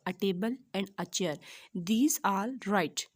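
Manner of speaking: slow, careful classroom reading; slow model reading. Shown as a demonstration. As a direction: read each phrase out slowly and clearly. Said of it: "ये हमारे तीनों जो sentence होंगे".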